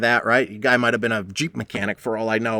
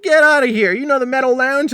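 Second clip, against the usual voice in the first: amazed; 13 semitones higher, 4 dB louder than usual. Retold or imitated. Veteran amazed by coincidence.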